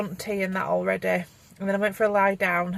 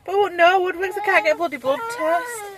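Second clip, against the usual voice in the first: higher, louder exasperated complaint; 10 semitones higher, 4 dB louder than usual. Real, not acted. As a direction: vent the frustration. Frustrated about blood test.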